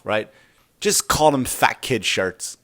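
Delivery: ordinary delivery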